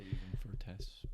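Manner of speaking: whisper. Real, not acted. Whispered aside.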